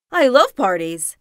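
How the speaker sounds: loud, eager wolf voice; hopeful wolf. A narrator's reading. Wolf hearing about the banquet, loud and hopeful. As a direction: burst out loudly with hope. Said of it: "said the wolf hopefully".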